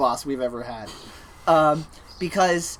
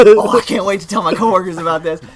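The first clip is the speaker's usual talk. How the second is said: loud, higher, mock-giddy excitement; gleeful anticipation; 4 semitones higher, 10 dB louder than usual. Worker itching to tell coworkers about a bad customer, loud and gleeful. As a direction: relish the gossip out loud. Acted glee at gossip to come.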